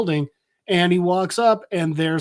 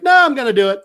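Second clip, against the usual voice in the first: louder, higher dismissive outburst; mock stubborn refusal; 6 semitones higher, 7 dB louder, much faster than usual. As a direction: cut off objections loudly. Voicing Freeze stubbornly overruling objections.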